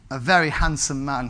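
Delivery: ordinary delivery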